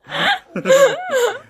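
real squealing burst of laughter; high squealing laugh; high shrieking laugh. Real, not acted. Laughing at failed twister.

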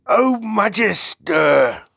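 loud, high, drawn-out acclaim; reverent giant's cry. A narrator's reading. BFG hails the Queen, loud reverent cry. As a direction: cry it out in slow, grand homage. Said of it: "cried the B F G".

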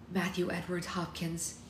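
sharp gasp, name fading away; gasp, then let the name die out; gasp then trailing off. Acted. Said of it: "Her voice trailed to nothing".

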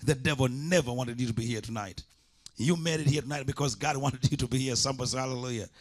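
ordinary delivery

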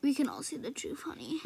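fast hushed aside; whispered gossip to the viewers; conspiratorial whisper. Acted. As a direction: lean in and whisper the secret.

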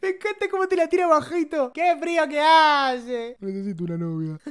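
hammed-up copy of a lonely guy's plea; imitating Kiko. Imitating Kiko right after his clip.

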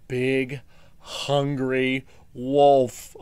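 slow, loud, menacing growl; scary wolf voice. Pretend. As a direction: drag it out like a big bad wolf. Introduces the villain wolf.

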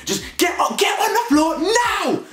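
very high shouted order, slow; mock police yell. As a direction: scream it like a cop out to kill. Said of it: "the way they talk like they're trying to kill you".

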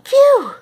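loud, high-pitched "phew" of relief; exaggerated storybook sigh of relief; relieved phew. A narrator's reading. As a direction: let out a big high phew. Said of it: "she said to herself as she sat down".

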